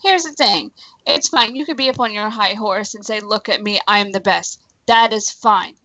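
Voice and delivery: ordinary talk, nothing marked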